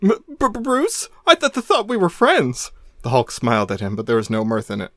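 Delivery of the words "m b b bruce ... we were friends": put-on high, stuttering terrified boy; higher frightened stutter; stammering fear. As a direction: stammer it out in panic. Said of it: "eyes widened in fear".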